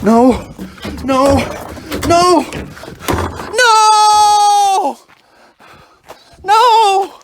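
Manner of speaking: loud, rising into a crying shout; building anguished no. Real, not acted. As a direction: despair grows with each no. Discovering the trash was taken.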